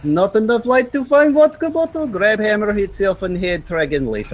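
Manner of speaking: high-pitched bad Russian accent. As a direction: ham up a broken Russian joke. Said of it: "my very bad Russian joke".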